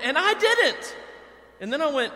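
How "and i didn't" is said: very high, loud crestfallen wail; dismayed squeal. Retold or imitated. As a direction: wail it as a sudden self-pitying realization. Realizing own lack, at home.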